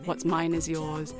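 ordinary talk, nothing marked

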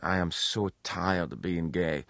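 weary, drawn-out mock complaint; slow, tired self-mocking lament; exhausted. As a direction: sigh it out slowly, worn out. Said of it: "I was exhausted, and I went".

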